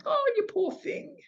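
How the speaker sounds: very high, pitying coo in broad Australian; broad Australian accent. Retold or imitated. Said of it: "This very broad Australian accent".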